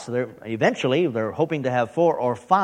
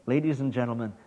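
hushed, lower, mock-reverent announcement; mock solemnity; 5 semitones lower, 8 dB quieter than usual. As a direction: present it softly with pretend grandeur. Mock-solemn praise of own accent.

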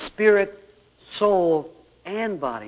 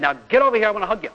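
stern command; 5 semitones higher, 5 dB louder, much faster than usual. Retold or imitated. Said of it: "I could have said".

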